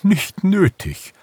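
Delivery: slow, high-pitched owl voice; old owl. A narrator's reading. Old owl turns down the hare's offer, in owl voice. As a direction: draw it out as an amused old owl. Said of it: "lachte die alte Eule".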